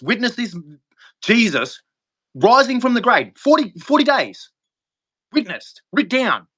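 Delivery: high, urgent, building insistence; fervent insistence. Real, not acted. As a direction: press it home, rising. Insisting on the witnesses.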